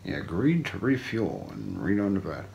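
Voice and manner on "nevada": ordinary delivery, nothing marked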